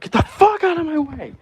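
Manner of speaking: over-the-top New York accent screaming. Shown as a demonstration. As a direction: yell it like an angry New York cabbie. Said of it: "that's essentially just a New York accent right there".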